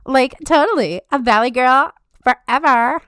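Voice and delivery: put-on high, loud Valley Girl gush; loud, high Valley Girl catchphrase. Shown as a demonstration. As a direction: drop straight into the Valley Girl. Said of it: "Valley Girl voice forever".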